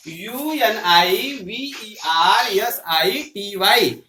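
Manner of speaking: letter by letter, slow and drawn out; slow spelling. Shown as a demonstration. Teacher spelling University for students, slow letter by letter.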